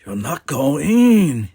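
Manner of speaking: high, loud, drawn-out yelp of disbelief; disbelieving yelp. A narrator's reading. Louis stares at his friend, yelping in disbelief. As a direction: let it rise loudly into incredulity. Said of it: "he yelped, his voice rising with disbelief".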